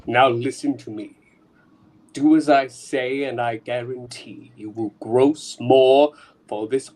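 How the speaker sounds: low menacing mastermind voice; sinister villain. Acted. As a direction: gloat like a criminal boss. Villain boss speaks.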